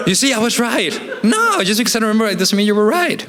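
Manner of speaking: high, laughing mock argument voice; mock triumphant partner. Petty partner to spouse, high laughing mock argument.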